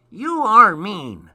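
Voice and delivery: high, loud, slow sulky elf; cross elf. Acted. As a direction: scold him like a hurt little elf. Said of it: "said the elf crossly".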